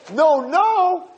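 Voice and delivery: very high acted cry; frantic protest. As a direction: cry out to stop them. Husband shouts to stop execution.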